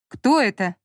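a touch of displeased surprise; ask, mildly put out; surprised and displeased. A narrator's reading. Zhanna answering an unknown caller, surprised and displeased. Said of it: "удивлённый и недовольный голос".

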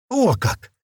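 high-pitched, surprised exclamation; sincere surprise. A narrator's reading. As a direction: react as if truly amazed. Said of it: "искренне удивился парнишка".

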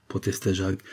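ordinary delivery, nothing marked

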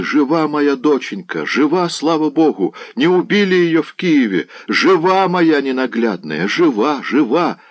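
high, overjoyed exclaiming; crazed joyful cry. A narrator's reading. Old man learning his girl is alive, high jubilant cry. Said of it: "закричал, как безумный".

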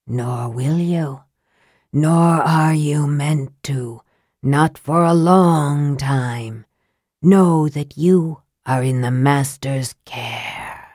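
low, slow and soft, a dead voice from nowhere; dry, soft wraith voice. A narrator's reading. Wraith delivers the Master's message to Raistlin, low and soft. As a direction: breathe it like ash from an unseen mouth. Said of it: "The voice was dry as bone and soft as ash".

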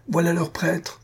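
high, hostile crowd voice pointing someone out; angry mob cry. Acted. Brahmans spot the priest and cry out, high and hostile. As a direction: jeer it like a mob spotting its target. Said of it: "s'écrièrent-ils".

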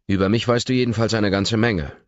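loud, commanding snap; snapping at her. A narrator's reading. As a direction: bark it at her, angry and high. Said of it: "herrsche ich sie an".

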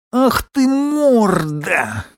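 high, slow, outraged cry; angry shout. Acted. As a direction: yell it at the screen in fury. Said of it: "крикнул дикобраз".

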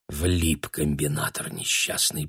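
low, slow, soft thinking to oneself; inner thought with conviction. A narrator's reading. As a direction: think it privately, hushed and sure. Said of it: "подумала Таня с ещё большей убеждённостью".

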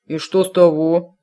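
challenging retort; defiant. A narrator's reading. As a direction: throw it back defiantly. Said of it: "с вызовом спросила голова".

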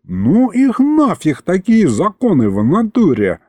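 a small demon refusing physics; indignant imp. Acted. Aristofan protesting the laws of physics.

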